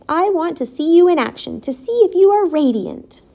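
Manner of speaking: high-pitched directing voice; commanding. A narrator's reading. Charlotte testing whether the pig is radiant, commanding. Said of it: "commanded Charlotte".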